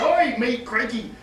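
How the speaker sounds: hammed-up New Zealand bartender; mock New Zealand accent. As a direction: put on a rough attempt at a New Zealand accent. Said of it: "in whatever sort of crazy accent they have down there".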